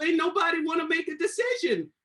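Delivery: breaks into high laughter on the punchline; laughing. Real, not acted. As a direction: laugh through the end of the line. Laughs at own story.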